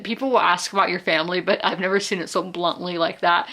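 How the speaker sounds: normal talking voice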